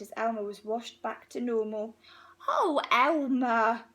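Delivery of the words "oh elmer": slow, loud gasp of laughing delight; a mild old elephant's gasp. Acted. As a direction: catch your breath around his name. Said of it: "gasped an old elephant".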